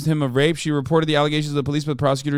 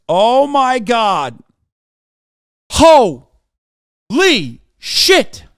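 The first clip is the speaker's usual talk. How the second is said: drawn-out, very slow, louder gasp of disbelief; stunned disbelief; 6 semitones higher, 7 dB louder, much slower than usual. Real, not acted. Streamer reading Peterson's tweet, stretched-out stunned disbelief. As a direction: stretch it out in shocked amazement, much higher and louder.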